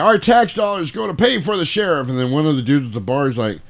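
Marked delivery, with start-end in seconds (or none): 0.0-2.1 high-pitched riled-up southern yokel; mock southern barroom griper; southern yokel accent. Yokel in the bar riling everybody up, southern accent. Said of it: "I love doing these southern yokel accents".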